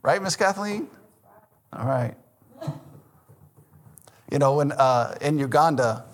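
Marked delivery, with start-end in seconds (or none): none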